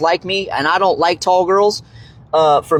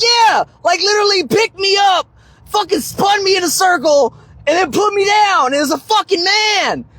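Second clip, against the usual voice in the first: shouting to a friend; 13 semitones higher than usual. Retold or imitated. Man shouting to his friend Dan over club music, excited. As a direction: yell it over club music to your buddy.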